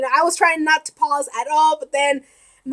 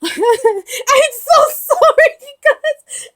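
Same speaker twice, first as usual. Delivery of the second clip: laughing apology; 10 semitones higher, 5 dB louder, much slower than usual. Real, not acted. Apologising after screaming, laughing.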